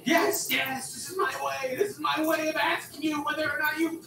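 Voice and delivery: very high-pitched, strained shout; exasperated outburst. Acted. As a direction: snap back at partner, voice shooting way up. Exasperated partner demanding an answer.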